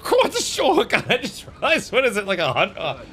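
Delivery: high, startled burst of disbelief; can't believe the number; shocked surprise. Real, not acted. Shocked at hype train count.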